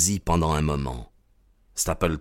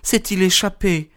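high-pitched urgent question; high woman's voice, crying out; anxious cry; 11 semitones higher than usual. A narrator's reading. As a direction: exclaim it high, as a worried woman. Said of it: "s'écria-t-elle".

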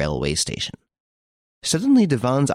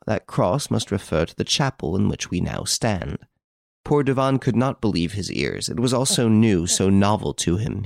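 plain reading voice

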